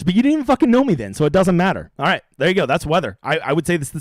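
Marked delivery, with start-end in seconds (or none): 0.0-1.1 fast, high, punchy retort; excited outburst. Real, not acted. Ranting at a new viewer, fast and high excited jab. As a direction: throw it back at the viewers, fast.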